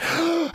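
a sharp, high, hammed-up gasp of shock; mock gasp. Pastor gasps in mock outrage at a critic's insult. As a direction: gasp as if stung by the words.

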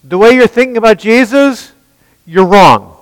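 loud, high preaching voice; fiery sermon delivery. Acted. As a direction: preach it hard to the room. Preacher addressing congregation forcefully.

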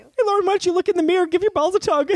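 high, fast mocking chirp; Shorzy impression. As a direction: rattle it off like a trash-talker.

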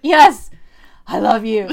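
hammed-up heroine caving in loudly; mock confession burst. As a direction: blurt out the confession. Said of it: "And finally she's like".

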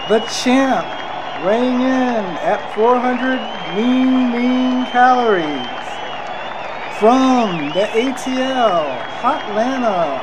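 hammed-up ring announcer, long held syllables; mock boxing intro, each phrase stretched out; Michael Buffer impression. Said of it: "I'm trying to do Michael Buffer".

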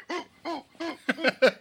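high excited ooh hoots; excited hooting. Retold or imitated. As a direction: hoot like a wild fan. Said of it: "jumping and screaming".

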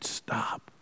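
a low, soft whispered stop; whispered command. Retold or imitated. Preacher voicing God stopping Abraham, whispered. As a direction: breathe the word out like a secret. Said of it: "he would have heard a whisper".

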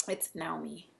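Naomi said the Japanese way, nah-OH-mee with even syllables; Japanese pronunciation. Shown as a demonstration. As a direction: Naomi with evenly weighted Japanese vowels. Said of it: "That's the Japanese way of pronouncing it".

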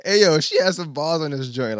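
loud excited exclamation. Real, not acted. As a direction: burst out hyped about the track. Hyped after laughing at lyric.

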